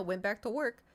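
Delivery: usual speaking voice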